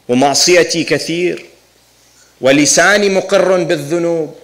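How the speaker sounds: solemn recited dua; Arabic supplication recitation. Acted. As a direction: recite each phrase separately, with pauses between.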